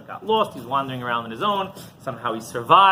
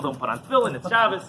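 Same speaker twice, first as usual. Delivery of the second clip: ordinary delivery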